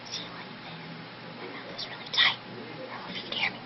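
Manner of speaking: a tight-lipped whisper, mouth barely open. Shown as a demonstration. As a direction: whisper through pursed lips to show the technique. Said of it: "when you whisper".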